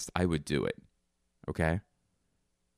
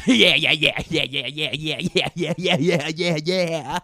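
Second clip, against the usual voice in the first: very high, loud yeah-yeah laughing; silly fake laugh; 11 semitones higher, 9 dB louder than usual. Joke scene, answering knock-knock with a silly laugh. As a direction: bark out yeahs as a goofy laugh.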